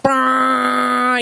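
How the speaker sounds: playful imitation of a vuvuzela honk; vuvuzela blast. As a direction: imitate a plastic stadium horn with your voice. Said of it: "it's a really loud horn sound".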